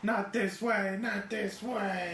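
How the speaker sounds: high, slow lament; high drawn-out mock despair; mock anguish. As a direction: moan it like a betrayed hero. Austin WrestleMania callback.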